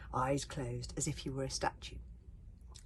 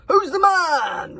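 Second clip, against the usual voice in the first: high, loud, slow roar; roaring boast; 11 semitones higher, 17 dB louder, much slower than usual. A narrator's reading. Chief berserk roaring to his crowd for praise. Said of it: "roared the chief berserk".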